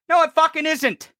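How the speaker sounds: angry, emphatic insistence; insistent contradiction. Real, not acted. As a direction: repeat the denial forcefully, pitch up. Repeats rebuttal more forcefully.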